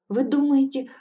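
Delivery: put-on young woman asking the witch, desperately hopeful. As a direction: beg for reassurance. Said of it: "с отчаянной надеждой спросила я".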